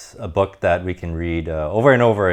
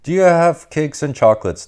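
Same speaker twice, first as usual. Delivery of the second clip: higher and louder, an eager greedy shout; greedy cry; 9 semitones higher, 6 dB louder than usual. Acted. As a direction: shout it into the cave, hungry for cake. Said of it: "the highway rat cried".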